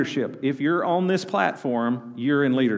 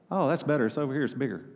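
ordinary talk, nothing marked

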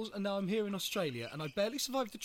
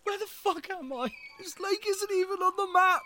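confused shout; 10 semitones higher, 5 dB louder than usual. Acted. Lost man arrives, confused.

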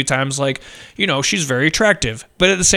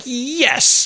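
higher, stretched-out "yes"; retold satisfaction; 6 semitones higher than usual. Viewer relishing the punch, satisfied cheer. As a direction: savour it as a long victorious yes. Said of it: "You're like".

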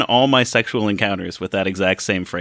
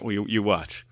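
usual speaking voice

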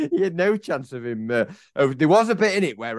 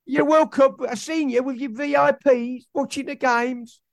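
high, hammed-up ranting fan; mock rant at owner; 13 semitones higher than usual. Fan ranting at owner Prince about pipes, hammed-up high voice.

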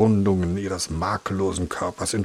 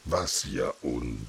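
slow, low mimicking of her husband; mocking imitation; 8 semitones lower, 8 dB quieter, slower than usual. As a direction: ape the husband's grumble. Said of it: "Äffte die Königin ihren Mann nach".

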